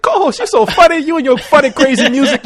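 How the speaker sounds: high teasing gushing voice; mocking fan voice. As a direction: gush like an impressed fan. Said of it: "Everybody's like".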